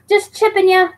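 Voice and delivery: high teasing singsong; playful high-pitched ribbing; mock sing-song tease. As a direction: tease him in a high put-on voice. Teasing repeat in put-on voice.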